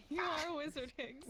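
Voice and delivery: cracking up mid-line; laughing through a movie quote. Retold or imitated. Harry Potter line, laughing.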